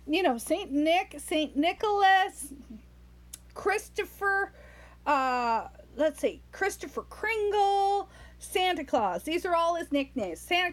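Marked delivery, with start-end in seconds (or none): none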